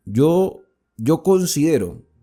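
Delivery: ordinary delivery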